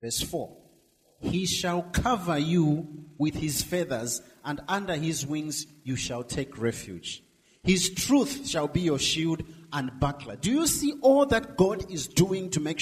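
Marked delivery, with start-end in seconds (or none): 1.2-4.2 slow, weighty scripture reading; preaching scripture. Acted. Preacher reading the psalm to the congregation, slow and weighty. 7.6-10.4 slow, weighty scripture reading; preaching scripture. Acted. Preacher reading the psalm to the congregation, slow and weighty.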